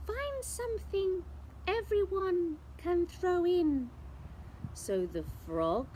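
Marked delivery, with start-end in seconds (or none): 0.1-3.9 high, cheery witch; with a grin. Acted. Witch inviting friends to fill cauldron, cheerful. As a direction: grin as you invite them. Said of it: "with a grin".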